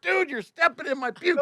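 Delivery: high-pitched retold complaint; exasperated protest. Sick driver complaining to the man hovering over him, high and exasperated.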